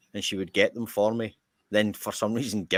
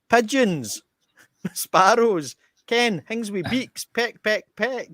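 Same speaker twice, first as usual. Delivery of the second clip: high, raised-voice list of hints; shouting in; 12 semitones higher, 4 dB louder, slower than usual. Retold or imitated. Friend outside the shop, high shouted prompting. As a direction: call helpfully from outside, high and insistent. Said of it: "outside the shop shouting in".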